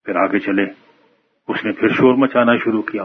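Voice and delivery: ordinary delivery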